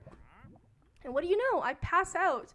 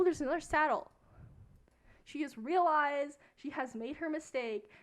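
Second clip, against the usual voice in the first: usual speaking voice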